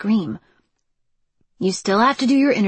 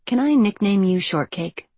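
ordinary delivery

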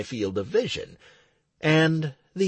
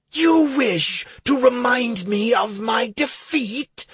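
enraged hermit; 10 semitones higher, 4 dB louder than usual. Acted. Enraged hermit accusing hero.

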